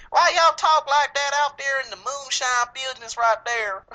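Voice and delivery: high, loud, slow Southern drawl; mock Southern accent. Making fun of a little cousin out there, exaggerated high Southern accent. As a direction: put on a drawn-out, mocking Southern twang. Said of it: "I got Southern family. I make fun of them".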